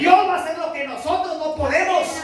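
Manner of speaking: fervent pulpit preaching. Acted. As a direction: preach it out to the congregation. Pastor preaching at full force.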